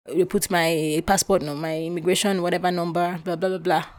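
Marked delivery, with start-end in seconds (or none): none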